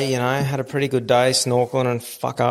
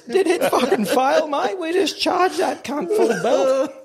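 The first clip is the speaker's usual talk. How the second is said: much higher, slow, laughing through the outrage; laughing disbelief; slower than usual. Retold or imitated. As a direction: laugh while you mock the excuse. Mocking crew excuse, laughing.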